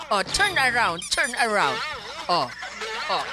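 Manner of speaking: ordinary delivery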